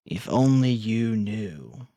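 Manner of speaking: ordinary talk, nothing marked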